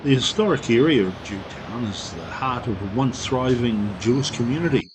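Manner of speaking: even documentary voiceover read; travel narration. Acted. Reads tourist description.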